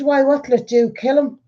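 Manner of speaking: dismissive nurse. Retold or imitated. Retelling the nurse's offhand reply. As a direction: toss it off like it's nothing. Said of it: "But it was the way she said it".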